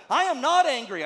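loud, insistent "I am not angry"; emphatic denial. Retold or imitated. Counselee flatly denying anger to the pastor, loud and insistent. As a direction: plant your feet and deny it hard. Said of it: "someone let me know emphatically".

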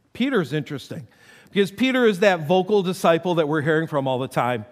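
ordinary talk, nothing marked

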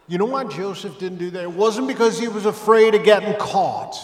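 loud, high, driving preacher's shout; full-force preaching. Acted. Preacher thundering at his church about Joseph. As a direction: hammer it home.

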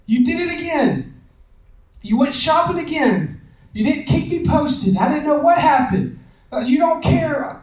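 high-pitched, heated mock accusation, not shouted; accusing attack. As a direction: hurl the blame at her the moment she walks in. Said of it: "I attack her as soon as".

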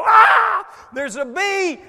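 long high shriek, then shouted alarm; very loud, acted panic; sudden scream. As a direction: scream out as if startled by a bee. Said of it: "all of a sudden you scream".

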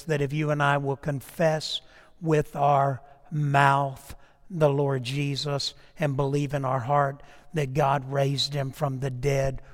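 usual speaking voice

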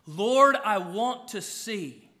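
loud cry on Lord, then slow and pleading; desperate cry. Acted. The blind man calling out to Jesus, a loud desperate plea.

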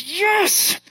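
very high mock-furious squeal; angry toddler. Frustrated toddler answering her dad, high angry squeal. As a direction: imitate a frustrated little kid snapping back. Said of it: "but just angry".

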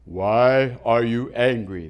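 low, slow, solemn voice of God; low booming divine question. Acted. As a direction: speak slowly as a grave, low God. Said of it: "That's God".

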